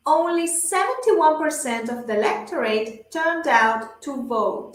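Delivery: slow, carefully paced English model sentence; measured language-teacher read-aloud; shadowing model reading. Shown as a demonstration. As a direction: read it slowly for learners to repeat. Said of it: "yo leo las frases y ustedes repiten".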